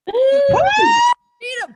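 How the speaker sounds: high, loud call to the crowd; rally shout. Real, not acted. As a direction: belt the name out to the room. Calling out district name.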